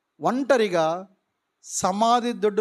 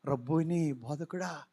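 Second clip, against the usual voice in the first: softer, lower, awed murmur; hushed recognition; 6 semitones lower, 10 dB quieter than usual. Acted. Mary recognizing her teacher, hushed and low. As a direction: breathe the name in wonder.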